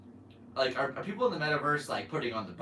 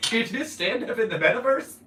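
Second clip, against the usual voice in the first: incredulous; 8 semitones higher, 7 dB louder than usual. Real, not acted. Incredulous at a listener's comment.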